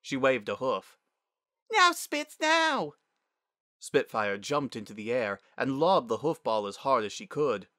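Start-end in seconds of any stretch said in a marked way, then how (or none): 1.7-2.9 put-on very high young girl's shout; squeaky, loud kid in play; urgent child's call. A narrator's reading. Child calling for the pass.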